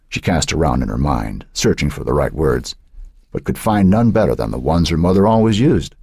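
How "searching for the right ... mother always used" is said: ordinary delivery, nothing marked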